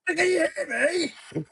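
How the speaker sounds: very high, strained Norfolk caller; Norfolk accent. Pretend. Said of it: "can't do a strong enough Norfolk accent".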